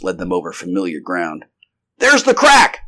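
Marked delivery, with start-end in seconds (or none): 2.0-2.8 very high, loud call; alarmed cry. A narrator's reading. Lieutenant spotting the monster's escape route, alarmed cry. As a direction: shout a sudden warning. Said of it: "cried the lieutenant".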